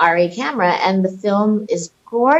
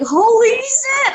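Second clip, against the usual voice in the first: much higher and slow, stretched-out amazement; retold freak-out; much slower than usual. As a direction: let the disbelief soar high and drawn out. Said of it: "freaking out".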